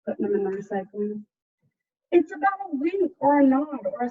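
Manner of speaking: put-on lively picture-book narration for kids; story reading. A narrator's reading. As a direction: read it like a storyteller to a class. Teacher reads picture book aloud.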